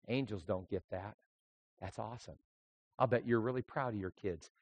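very soft, low Gabriel reply; soft, low murmur of an onlooker; hushed aside. Acted. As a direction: drop low and quiet, as an angel quietly taking it in. Gabriel reacting to God's fatherly pride.

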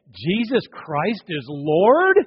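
slow, rising Jesus Christ is Lord?; doubtful question. Shown as a demonstration. Preacher mocking a reluctant confession, slow and doubtful. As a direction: drag it out unsure, ending up. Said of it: "some that will say".

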